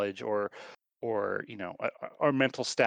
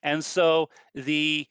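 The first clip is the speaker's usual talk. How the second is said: ordinary talk, nothing marked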